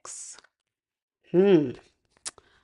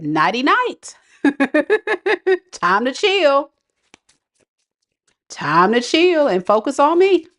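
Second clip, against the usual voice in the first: higher-pitched, playful send-off; breezy sign-off quote; 7 semitones higher than usual. Acted. You telling a stressed ex nighty night, playfully dismissive. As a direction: act out a carefree goodbye with a laugh. Voices listener's carefree sign-off.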